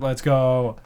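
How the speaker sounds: usual speaking voice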